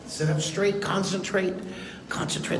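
quiet, dropping to a whisper on the last concentrate; hushed. Shown as a demonstration. Teacher hushing the kids to focus, fading to a whisper. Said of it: "Now watch, concentrate".

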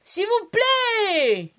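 high, drawn-out call for attention; comic sing-out to get the waiter over. Shown as a demonstration. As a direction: stretch each phrase long and high to summon a waiter. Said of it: "That kind of tone".

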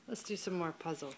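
ordinary delivery

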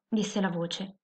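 ordinary delivery, nothing marked

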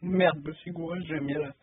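usual speaking voice

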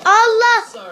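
loud high startled "Allah!"; sudden loud exclamation; startled cry. Real, not acted. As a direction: cry out in shock. Startled in game chaos.

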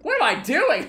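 high, loud self-scolding yelp; exasperated cry. Real, not acted. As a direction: scold yourself in disbelief. After a silly death.

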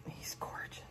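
breathless whispered awe. Real, not acted. To the doll, an awed whisper. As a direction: whisper it like she can't believe it. Cooing over a reborn doll.

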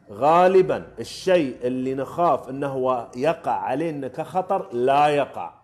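slow, deliberate statement of the rule; slow emphatic rule. Real, not acted. Coach spelling out the rule to learners, slow. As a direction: lay the rule out word by word.

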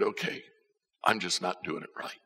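normal talking voice

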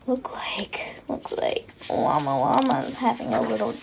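hushed aside; whisper. Real, not acted. Commenting on llama's temper tantrum in a whisper. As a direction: confide it to the viewers.

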